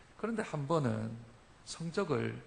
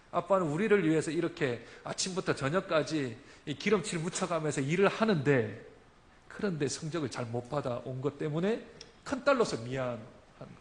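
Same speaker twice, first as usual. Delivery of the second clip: ordinary delivery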